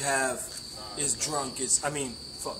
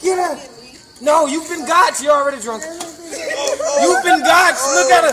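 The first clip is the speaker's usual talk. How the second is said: high excited shouts; excited yelling; 13 semitones higher, 12 dB louder than usual. Real, not acted. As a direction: yell it with glee. Egging on drunk friend.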